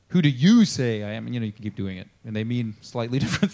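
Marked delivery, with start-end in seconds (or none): 0.1-1.2 YOU punched louder than the words around it; stress on YOU. Shown as a demonstration. Preacher showing how inflection shifts the question, stress on YOU. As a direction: hit YOU hard in "who do YOU say". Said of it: "with the inflection on the different words".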